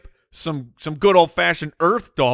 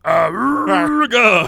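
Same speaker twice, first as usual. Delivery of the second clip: silly clown; 8 semitones higher, 6 dB louder, much slower than usual. Pretend. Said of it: "that's the aliens' language".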